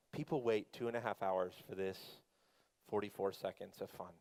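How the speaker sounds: low, quiet deadpan aside; underwhelmed. Retold or imitated. Preacher voicing riders after a short ride, underwhelmed. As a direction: drop it low and soft, unimpressed.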